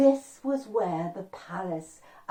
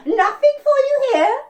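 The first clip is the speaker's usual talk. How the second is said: very high, loud bird screech; crane squawk; 12 dB louder than usual. A narrator's reading. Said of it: "stick out its beak and go".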